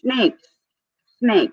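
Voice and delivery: high, alarmed one-word reply; snakes. Real, not acted. American blurting out his fear of Australia, high and mock-alarmed. Fearful joke about Australian wildlife.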